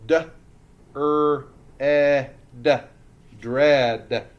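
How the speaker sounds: "dread" loudly split into D, er, eh, d, then blended; sounding out. Shown as a demonstration. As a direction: punch each sound of "dread" separately. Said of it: "Roller coasters ready?".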